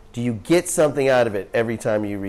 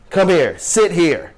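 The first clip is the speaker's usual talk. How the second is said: a louder, higher, slow call to sit, laughing through it; laughing while playing the friend's command; friend's brisk order; 6 semitones higher, 5 dB louder, slower than usual. Friend Gopal orders him to sit.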